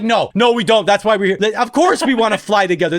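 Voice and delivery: of course we do, obviously; exasperated retort. Retold or imitated. Retold exasperated reply.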